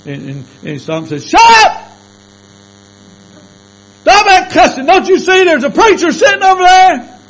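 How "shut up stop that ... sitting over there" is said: high, loud shout; furious shout. Retold or imitated. Coworker shouting at the cussing men, loud and high. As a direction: bellow it across the break room.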